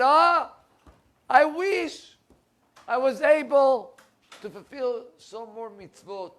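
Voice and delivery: high, loud, slow lament; tearful regret. Retold or imitated. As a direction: cry out the wish that came too late. Dying mother cries with regret.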